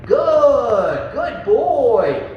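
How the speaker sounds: bright, high praise for the dog, good stretched; praising a dog so it really hears it; upbeat praise. Shown as a demonstration. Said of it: "Don't just say good".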